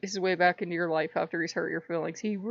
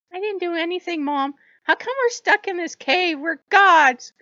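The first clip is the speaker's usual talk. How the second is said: put-on much higher, complaining kid; whiny child; 10 semitones higher, 6 dB louder than usual. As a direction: whine to your mom like a spoiled child. Baby Hermes whines to mother.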